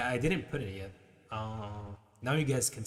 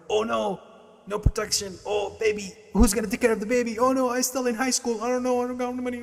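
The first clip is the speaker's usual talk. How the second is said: much higher, loud mock wail of a panicking teenager; mock panicked teen; 12 semitones higher, 9 dB louder than usual. Streamer mocking a panicking pregnant teen, high and loud. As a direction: ham up a frantic high school girl.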